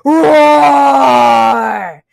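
long, loud lion-like roar; big roar. A narrator's reading. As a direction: roar it out at full volume. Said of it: "opened his mouth".

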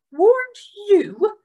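high, loud outraged echo; indignant Trotter. Acted. Trotter echoing Gilly's complaint, outraged. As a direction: throw the words back at her.

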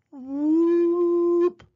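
long, high, loud siren whoop; imitated launch whoop; rising whistle-like whoop. A narrator's reading. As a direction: slide up and hold it high. Imitated gadget noises.

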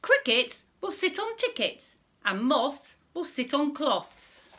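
lively, high storybook voice, a little slow; reading to children. Acted. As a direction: read it out big for little listeners.

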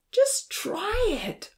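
very high, drawn-out coaxing; insistent urging. A narrator's reading. Odin pushing Nancy to try the chicken, insistent. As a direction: urge them on with a high, slow stretch.

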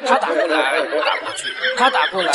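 laughing. Acted. Student reading silly essay laughing.

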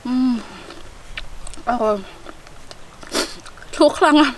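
talking while chewing garlic; chewing with a pungent grimace; mouth full, chewing. Real, not acted. Seen 3: Ate garlic at -4; lips pursed, chewing, grimacing while speaking.

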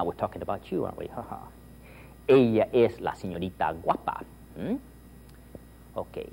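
usual speaking voice

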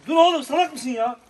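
high, loud startled yell at the dog; sharp yell at dog. Real, not acted. Man feeding stray dogs yells as one bites his hand.

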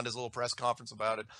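ordinary talk

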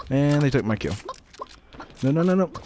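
high, loud cry of protest; outraged protest. Real, not acted. Gamer protesting loudly as his kill is stolen.

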